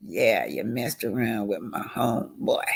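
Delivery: whispered mock accusation; whispered imitation. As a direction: hiss the quote under your breath. Voicing someone, whispered.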